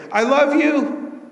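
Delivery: loving declaration. Acted. God's words voiced in sermon.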